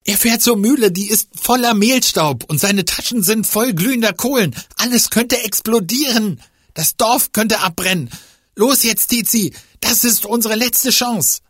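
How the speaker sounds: hoch, laut und gehetzt; panisch aufgeregt. Acted. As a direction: Alarm schlagen, das Dorf retten. Fiete drängt in Panik. (panisch aufgeregt)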